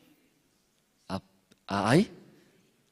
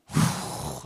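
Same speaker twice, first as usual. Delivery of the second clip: breathy wind gust; 10 semitones lower than usual. Acted. Cloud blows harder.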